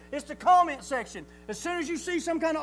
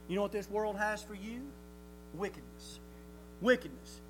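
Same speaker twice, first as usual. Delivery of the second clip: hushed dramatic answer; 3 semitones lower, 8 dB quieter than usual. Acted.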